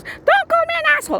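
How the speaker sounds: very high, fast squawk of outrage; indignant mock protest. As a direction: mimic an offended man in a high-pitched voice. Retold quarrel, man's indignant retort.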